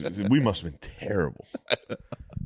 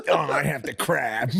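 higher, slow impression of needy groaning; mocking helpless groan; 8 semitones higher, slower than usual. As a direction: groan it out, pleading and pathetic. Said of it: "did an impression of me".

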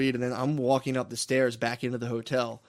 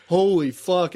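higher, louder stunned exclamation; retold amazement; 5 semitones higher, 6 dB louder, much slower than usual. Whole team watching the game stop, louder retold amazement. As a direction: react to a huge hit, mildly acted.

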